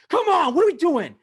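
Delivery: high, loud retold yell at the team; upset yelling. Upset fan yelling at his team on TV, re-enacted. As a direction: re-enact shouting at the TV. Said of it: "I'm yelling at the T V. I'm really upset".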